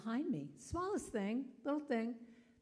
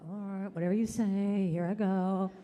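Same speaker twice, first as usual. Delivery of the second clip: mock surrender; 8 dB louder than usual. Believer giving in to God, loud mock surrender. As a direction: give in with a big comic sigh of words.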